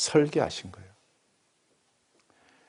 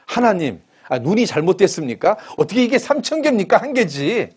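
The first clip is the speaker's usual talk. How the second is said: loud, much higher mock argument; mock protest; 7 semitones higher, 5 dB louder than usual. Preacher imagining himself as Job protesting to God, loud mock argument. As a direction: argue back with God in jest. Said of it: "제가 하나님께 따졌을 겁니다".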